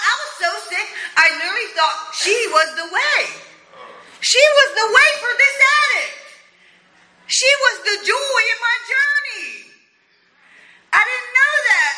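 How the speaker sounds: building joyful outburst; rising excitement. Real, not acted. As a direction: testify with rising passion. Emotional peak in testimony.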